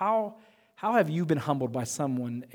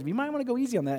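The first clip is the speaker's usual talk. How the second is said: higher mock professor voice; imitating the professor; 8 semitones higher, faster than usual. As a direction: lightly raise the pitch for the professor. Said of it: "but he goes".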